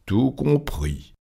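slow, soft, high-pitched answer; unruffled reply. Acted. Fogg answers Stuart while still playing, slow and unruffled. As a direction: stretch it out coolly.

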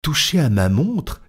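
mild narrated indignation; outraged cry. A narrator's reading. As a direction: recoil at the very idea. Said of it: "s'écria Passepartout".

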